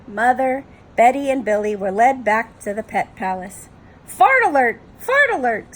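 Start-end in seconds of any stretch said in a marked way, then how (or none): 4.1-5.8 high, loud parrot alarm; shrieky parrot warning call; squawking parrot. Acted. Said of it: "squawked the parrot".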